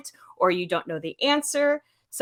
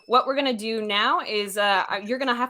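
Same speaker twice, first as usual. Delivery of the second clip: ordinary talk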